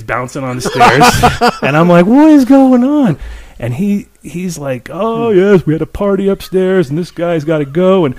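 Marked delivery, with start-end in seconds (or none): 2.0-3.2 high, loud, drawn-out disbelief; bewildered outcry. Retold or imitated. Tripping, watches man dragged downstairs. 4.9-8.1 high, casual neighbor imitation; mock-casual neighbor, pitched up; lightly voiced chatty neighbor. Voices neighbor casually dragging a guy.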